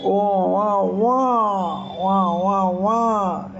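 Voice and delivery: slow, stretched mock wail; imitating baby cry. Shown as a demonstration. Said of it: "बच्चा रोता है".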